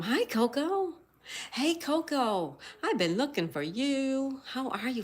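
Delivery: high, bright-pitched hello to a friend; light up greeting Coco in chat; excited greeting. Real, not acted. Streamer greeting regular Coco in chat, excited high voice.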